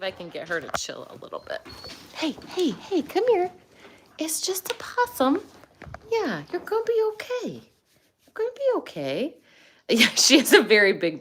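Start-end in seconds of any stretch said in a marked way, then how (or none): 2.1-9.4 high sing-song soothing of the dog; pet talk. Real, not acted. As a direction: reassure the dog she's fine. Calming barking dog.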